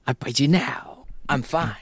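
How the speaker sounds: whispered creature voice; creepy whisper. Acted. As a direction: hiss it like a creature.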